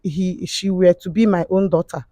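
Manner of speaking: ordinary delivery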